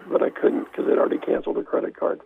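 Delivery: dropped to a near whisper; muttered under breath. Real, not acted. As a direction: throw away the last repeat quietly. Third repeat of the punchline.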